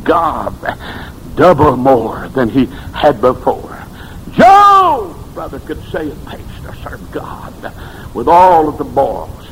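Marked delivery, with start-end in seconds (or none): 4.3-5.1 high, loud shout of the name; belting out the climax of the sermon; preaching shout. Acted. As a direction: cry it out to the back pews. Sermon whoop.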